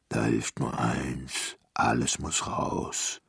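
slow, deep giant voice; ponderous big-giant delivery; resolute giant. A narrator's reading. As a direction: pronounce it low and very slowly. Giant decides to clear room.

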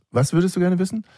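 high-pitched mock coaxing; prompting parent. Parent pretends son whispered a question, high coaxing voice. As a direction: retell it as a lifted, leading question.

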